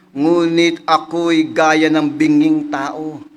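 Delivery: solemn, drawn-out scripture line; slow verse reading. Acted. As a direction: lean on every word of the verse. Reads the key verse.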